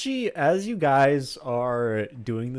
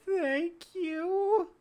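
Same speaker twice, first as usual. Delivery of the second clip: high, stammering nervous gnome; a jittery little gnome squeaking; 4 dB louder, slower than usual. Acted. Gnome thanks them.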